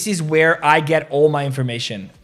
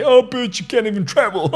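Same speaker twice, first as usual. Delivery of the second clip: mock taunt; 6 semitones higher than usual. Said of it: "he will just say".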